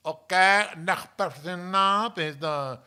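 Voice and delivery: put-on loud, high, drawn-out Spanish accent. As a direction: imitate the town lady's thick Spanish accent. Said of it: "got, like, an accent like this".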